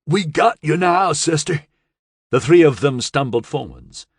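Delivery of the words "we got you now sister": strained through clenched teeth; gritted teeth. A narrator's reading. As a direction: push it out with effort. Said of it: "through gritted teeth".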